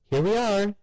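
high, eager housewife voice; chirpy mother proud of her shopping; cheery mother. A narrator's reading. Mother arriving.

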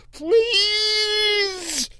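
very high, long screaming plea; boy screaming please; squeaky scream. Acted. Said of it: "the squeak rising through a scream".

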